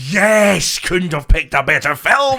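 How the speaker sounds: loud, high delighted cheer; excited. Real, not acted. Praising Jingle All the Way, loud and excited. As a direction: cheer for the film. Excited about favourite film.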